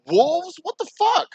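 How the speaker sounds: high, loud incredulous yelp; outraged disbelief. Real, not acted. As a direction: let the disbelief burst out.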